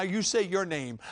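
ordinary talk, nothing marked

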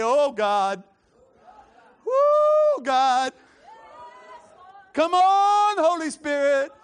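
very loud, high sung-out invocation; drawn-out worship whoop; crying out to God. Real, not acted. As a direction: call down the Spirit with held notes.

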